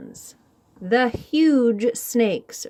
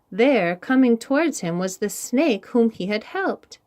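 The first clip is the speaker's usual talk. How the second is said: ordinary delivery, nothing marked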